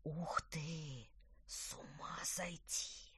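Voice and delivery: breathless, amazed whisper; excited whisper. Acted. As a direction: whisper in wonder, slowly. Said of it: "возбуждённый шёпот".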